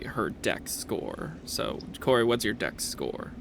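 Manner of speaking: ordinary delivery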